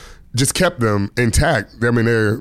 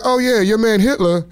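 cheeky jab; 13 semitones higher than usual. Retold or imitated. Comic's punchline in retold set.

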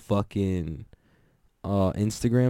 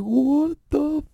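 very high, slow, stretched-out yell; rowdy American shout; 4 dB louder, much slower than usual. Retold or imitated. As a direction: holler it like a rowdy fan in the stands. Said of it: "the Americans are just like".

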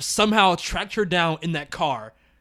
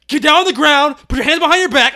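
higher, louder police commands; barking cop; 8 semitones higher, 9 dB louder than usual. Retold or imitated. Imagining police arriving at the fire, louder barked commands. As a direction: bark orders like a cop. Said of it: "Be like".

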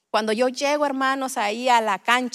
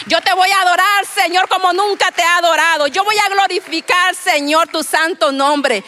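fervent prayer, louder and higher; eager praying; 5 semitones higher, 8 dB louder than usual. Retold or imitated. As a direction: cry out eagerly to the Lord. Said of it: "usted dice". (oración ferviente, más fuerte y más aguda)

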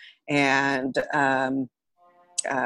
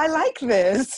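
slow, high, laughing delight; mock-delighted younger self; 9 semitones higher, 3 dB louder, slower than usual. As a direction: savour it like a new passion. Voicing her younger delighted self.

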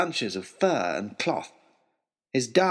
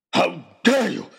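loud, higher furious roar; 6 semitones higher, 5 dB louder, slower than usual. Acted. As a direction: roar it at him. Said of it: "roared Oxshott".

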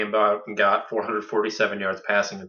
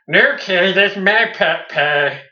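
higher, louder, slow and whiny Cartman; bad Cartman impression; 8 semitones higher, 7 dB louder, much slower than usual. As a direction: drag it out like a bratty cartoon kid. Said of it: "I don't think that's a good Cartman voice".

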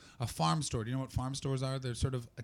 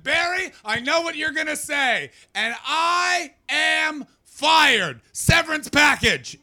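loud, much higher, very slow; hammed-up resignation; 11 dB louder, slower than usual. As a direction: drag out the defeat theatrically. Resigned reply to boss.

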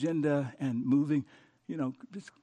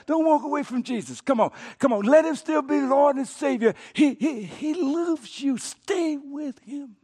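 loud, high urgent plea; pleading cry; 9 semitones higher, 8 dB louder, slower than usual. Retold or imitated. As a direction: call him back before he leaves. Pastor pleads with friend.

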